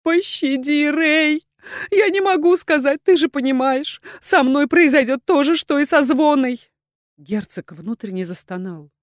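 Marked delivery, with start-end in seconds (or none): 0.0-6.6 high, loud, crying plea for mercy; sobbing, desperate woman; tearful pleading. A narrator's reading. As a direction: beg through tears, high-pitched. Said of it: "по щекам её бежали слёзы".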